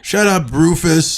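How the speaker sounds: high, slow, dismissive taunt; mean cartoon kid. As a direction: sneer at the tagalong in a high voice. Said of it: "cartoon character that gets shit on".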